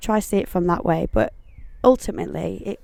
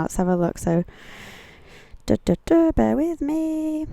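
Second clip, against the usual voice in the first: normal talking voice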